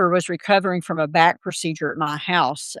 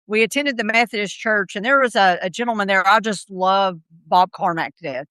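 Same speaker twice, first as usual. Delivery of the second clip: ordinary talk, nothing marked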